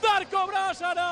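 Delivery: slow, high shouted name; shouting. Real, not acted. Commentator names the scorer.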